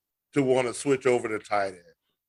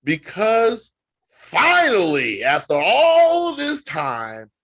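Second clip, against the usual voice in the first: dramatic buildup; 6 semitones higher, 6 dB louder, slower than usual. Acted. Dramatic buildup about Tebow.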